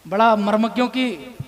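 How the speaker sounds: normal talking voice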